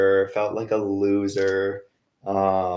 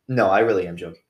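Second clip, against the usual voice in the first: ordinary talk, nothing marked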